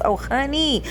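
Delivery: sing-song honeys rising high; sassy honey. Real, not acted. Commentator reacting with sass.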